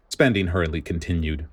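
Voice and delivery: usual speaking voice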